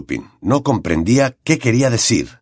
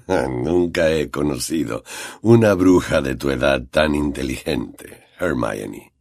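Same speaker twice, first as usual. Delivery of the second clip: Lupin's low voice; 7 semitones lower, 3 dB quieter than usual. A narrator's reading. Lupin compliments Hermione, low and soft. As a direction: lower and softer man's voice, wry.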